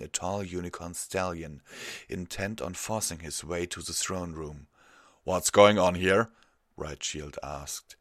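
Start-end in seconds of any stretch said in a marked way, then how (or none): 5.2-6.3 raised, commanding question; loud demand. A narrator's reading. Guard captain arriving at a commotion, loud demand. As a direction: demand an explanation loudly.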